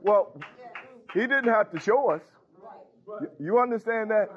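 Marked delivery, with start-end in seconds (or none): 0.0-2.2 mock shocked protest.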